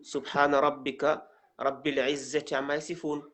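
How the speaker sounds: even, measured recited prayer; closing Arabic prayer recitation. Real, not acted. Teacher closing the lesson with a prayer, recited. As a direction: recite it as a closing formula, smoothly joined.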